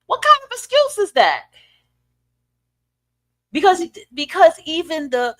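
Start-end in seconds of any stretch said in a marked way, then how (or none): 0.1-1.4 high-pitched, disbelieving demand; incredulous question. Real, not acted. Challenging the excuse made for a grown man, incredulous. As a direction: repeat it much higher, incredulous.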